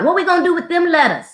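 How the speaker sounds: put-on high, fast childlike outburst; kid yelling out. Boy yells during math lesson, high and fast. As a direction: blurt it out like a baffled kid. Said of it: "he screamed out".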